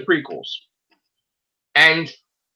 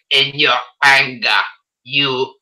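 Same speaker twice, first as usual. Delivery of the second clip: Emperor impression; 7 semitones lower than usual. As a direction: hiss it out like an old Sith lord. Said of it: "it's like".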